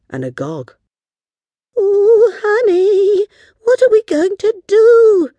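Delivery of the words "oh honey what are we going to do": very high, loud, worried cry; nervous wail. Acted. Girl turning to her friend for help, nervous high wail. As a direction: wail anxiously to your friend. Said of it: "asked Pickle nervously".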